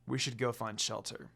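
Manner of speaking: soft, low warning; low murmur. A narrator's reading. Sesshomaru warning the others, low murmur. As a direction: murmur it under your breath.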